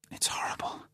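whispered through tears; tearful whisper. A narrator's reading. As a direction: barely breathe it, crying. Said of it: "Her eyes filled with tears".

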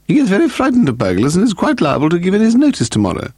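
high, severe lady's voice; stern hostess pitched well up. A narrator's reading. Hostess warns guest that Simpson may give notice, severe. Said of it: "Lady Delamore said severely".

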